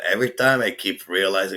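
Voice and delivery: ordinary talk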